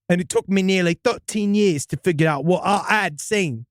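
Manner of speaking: storyteller in a put-on British accent; hammy English-accented first-person tale. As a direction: read it with an amateur British accent. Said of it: "Can you stick with this accent".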